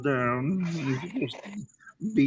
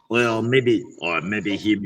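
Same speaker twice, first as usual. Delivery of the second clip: ordinary talk, nothing marked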